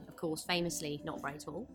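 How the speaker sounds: ordinary talk, nothing marked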